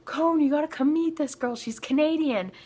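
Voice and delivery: high, excited calling out, not full volume; mock yelling. Tour manager calling Cone to meet her, mock yell. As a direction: imitate someone hollering a friend over. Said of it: "starts yelling at Cone".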